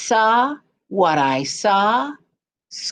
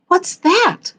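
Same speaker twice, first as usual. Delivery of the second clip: Pappy asking; 11 semitones higher than usual. Acted. Pappy asks about the knocking.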